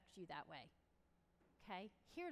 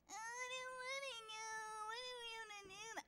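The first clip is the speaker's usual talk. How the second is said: mock a kid's high-pitched whine; whining; 11 semitones higher than usual. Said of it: "I did hate whining so".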